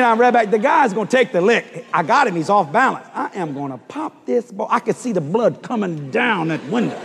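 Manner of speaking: normal talking voice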